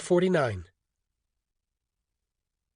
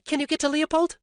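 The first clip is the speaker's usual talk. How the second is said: higher woman's voice, anxious; Orly, anxious; 11 semitones higher, faster than usual. A narrator's reading. Orly asking Alton for the doctor, anxious woman's voice. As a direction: ask urgently for help.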